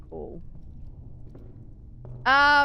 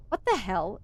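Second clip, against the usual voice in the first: normal talking voice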